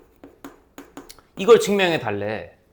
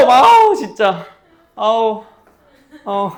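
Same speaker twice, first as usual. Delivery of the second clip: exasperated groan; 7 semitones higher, 10 dB louder, much slower than usual. Real, not acted. Exasperated after scolding student.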